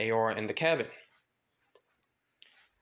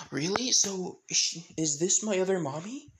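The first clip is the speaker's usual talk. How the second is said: put-on high-pitched child asking; wondering little-kid question, voice lifted; acted curious child; Eri; 6 semitones higher than usual. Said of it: "And Eri says".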